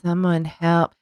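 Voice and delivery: slow, unexcited fake alarm; monotone mock panic. Co-host pretending a meteor is coming, in monotone. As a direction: drone the cry for help. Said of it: "Like that type of stuff".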